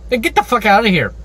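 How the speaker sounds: ordinary delivery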